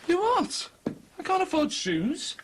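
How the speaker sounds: high, loud disbelief; shocked outburst. Acted. Husband told to buy shoes, shocked outburst. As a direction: balk at the cost, aghast.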